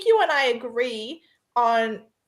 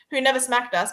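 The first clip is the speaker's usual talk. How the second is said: ordinary delivery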